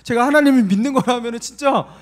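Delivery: loud, high-pitched pleading; indignant protest. Retold or imitated. Pastor defends his faith to God, loud indignant protest. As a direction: insist on it loudly, voice pushed up.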